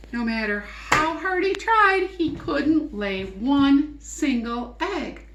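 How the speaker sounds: high, loud and strained; straining effort, voice squeezed high; straining to lay an egg. A narrator's reading. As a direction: push hard, holding breath.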